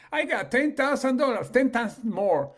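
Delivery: loud, high astonished cry; amazed neighbour. Acted. Neighbour at the mailbox, amazed and loud over the check. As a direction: shout it like you can't believe the check.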